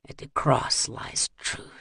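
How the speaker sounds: slow, tiny madman whisper. Acted. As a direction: breathe it almost unheard. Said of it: "started whispering in a voice so small".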